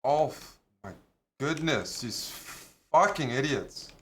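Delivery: loud, exasperated at teammates; angry rant. Real, not acted. Raging at teammates.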